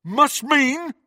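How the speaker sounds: high, loud, challenging echo; ferocious. A narrator's reading. Henchman turning on his leader, ferocious. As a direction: throw the words back furiously. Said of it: "with undisguised ferocity".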